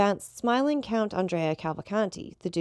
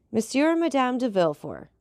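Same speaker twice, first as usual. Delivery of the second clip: called out high and loud to the room; a servant crying out the guests' names; announcing cry; 7 semitones higher, 4 dB louder than usual. Acted. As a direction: announce the guests in a ringing call. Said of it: "cried Baptistin".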